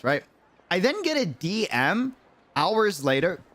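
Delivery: usual speaking voice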